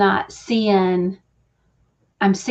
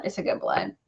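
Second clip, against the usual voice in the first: much lower and much softer, in a rumbling undertone; deep mock echo; 12 semitones lower, 10 dB quieter than usual. Echoing her own words to viewers, much lower and softer. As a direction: drop to a low mock-solemn murmur. Line repeated in a new voice.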